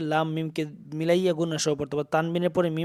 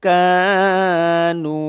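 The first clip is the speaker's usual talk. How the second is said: drawn-out recitation; 6 dB louder, much slower than usual. Shown as a demonstration. Teacher models madd for students, slow chanted recitation. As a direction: stretch the vowel of Kanu one alif long.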